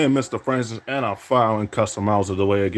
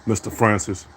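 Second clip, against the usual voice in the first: ordinary delivery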